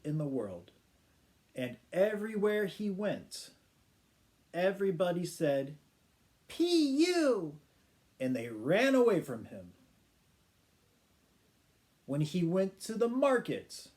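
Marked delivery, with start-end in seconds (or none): 6.5-7.6 disgusted crowd. Acted. Crowd reacts to smelly man.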